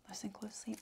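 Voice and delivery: hushed, breathy aside; demonic whisper. Acted. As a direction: whisper it close to the mic.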